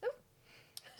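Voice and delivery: sharp unvoiced gasp into a laugh; shocked gasp. Real, not acted. Reacting to shocking detail.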